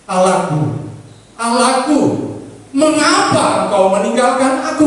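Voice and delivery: high, loud desperate cry; anguished cry. Acted. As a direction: cry out, forsaken. (seruan tinggi yang putus asa)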